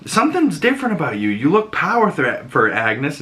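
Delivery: high, fast mock Herb; eager neighbor. Herb noticing Agnes changed, high and hurried. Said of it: "Herb's like".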